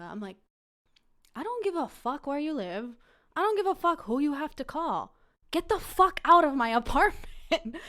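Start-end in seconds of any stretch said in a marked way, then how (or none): 1.3-7.8 high, loud indignant rant; acted fury. Woman throwing date out, furious and getting louder. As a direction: build to a retold angry shout.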